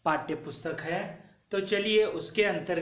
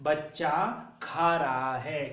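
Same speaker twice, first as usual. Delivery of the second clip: slow, deliberate reading for pupils; slow model pronunciation; 2 semitones lower than usual. Shown as a demonstration. Teacher models the sentence slowly for pupils. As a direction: say each word slowly and clearly. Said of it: "तो इसका उच्चारण कैसा करना है?".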